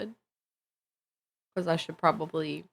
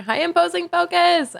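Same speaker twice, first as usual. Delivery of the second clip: excited greeting; 12 semitones higher, 8 dB louder than usual. Real, not acted. Greeting a chat viewer.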